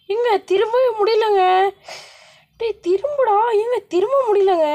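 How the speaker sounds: high-pitched, flustered wail; panicky complaint. Real, not acted. Gamer unable to turn around, panicky complaint. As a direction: plead in rising panic.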